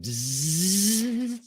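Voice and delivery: rising buzz of spinning barrels; long buzz climbing in pitch; whirring barrels. Acted. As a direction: imitate a Gatling gun winding up. Imitates gun barrels spinning up.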